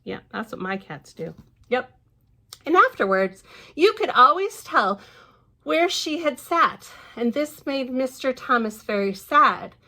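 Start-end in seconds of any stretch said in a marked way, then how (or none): 2.7-9.8 lively storybook reading voice; picture-book narration; reading to children. Acted. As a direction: read it out brightly to little ones. Reads the book aloud.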